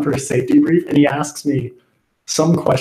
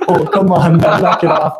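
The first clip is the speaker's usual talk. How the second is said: louder, higher imitation of exasperation; mock exasperated protest; 5 semitones higher, 4 dB louder than usual. Teased friend objecting to the music, mock exasperated.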